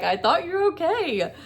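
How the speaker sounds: husband confused at finding his wife crying; puzzled husband. Retold or imitated. Husband's puzzled reply retold.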